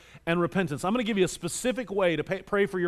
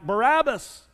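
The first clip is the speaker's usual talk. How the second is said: mock shout, pitched a bit higher; retold crowd shout; 4 semitones higher, slower than usual. Crowd answers the governor's question, mildly acted shout. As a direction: imitate a crowd calling out, moderately raised. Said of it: "The crowd shouted back".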